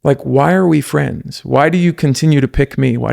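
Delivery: slow and earnest, a little moved; slow emotional question. Shown as a demonstration. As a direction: let the question land slowly. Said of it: "get me emotional thinking about it".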